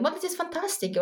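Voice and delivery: light mock awe; impressed inner voice. Guest voicing her reaction to creators, high delighted. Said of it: "And I'm like".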